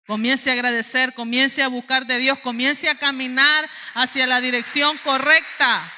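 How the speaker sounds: loud, driving pulpit call; forceful preaching exhortation. Real, not acted. Preacher charging the church to start walking, forceful exhortation. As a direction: build to a loud, insistent charge.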